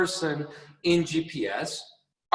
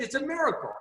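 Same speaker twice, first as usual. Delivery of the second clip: imitating Jim Rohn; 9 semitones higher, faster than usual. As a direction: lightly echo the mentor's wonder. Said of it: "he said".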